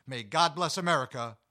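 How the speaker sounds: loud, bright sign-off read to the camera; show outro announcer. Acted. Host signing off to viewers, loud announcer read.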